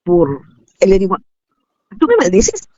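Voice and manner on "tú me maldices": incredulous question. Retold or imitated. Mother confronts daughter, retold.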